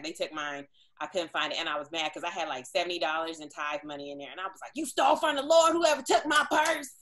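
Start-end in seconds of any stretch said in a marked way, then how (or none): 4.7-6.9 higher, louder mock outrage; indignant shout at the whole party; retold shout. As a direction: yell it like you're calling out the thief. Retold outburst over stolen tithe.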